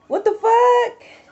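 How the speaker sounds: high, slow incredulous what the fuck; high, slow disbelief; stunned. Real, not acted. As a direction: drag it out in disbelief. Incredulous, drawn out.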